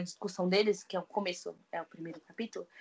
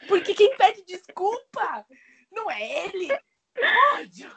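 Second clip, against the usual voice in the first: higher and louder, worked-up complaint; indignant rant; 9 semitones higher, 4 dB louder than usual. Real, not acted. Reviewer telling the character he doesn't deserve him, indignant. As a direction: vent real outrage at the character. Ranting angrily about a character.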